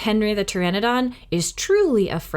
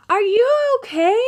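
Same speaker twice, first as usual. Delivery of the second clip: put-on much higher, louder little-girl call; Annie shouting; 5 dB louder than usual. A narrator's reading. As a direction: call down high from the treehouse. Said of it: "Annie shouted from the treehouse".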